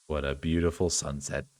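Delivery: put-on frail boy, softly; quiet frail boy. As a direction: speak low and soft like a sickly boy.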